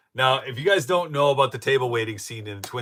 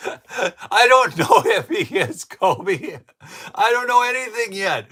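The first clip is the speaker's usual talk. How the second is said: laughing; 13 semitones higher, 10 dB louder, slower than usual. Real, not acted. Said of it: "still laughing after the impression".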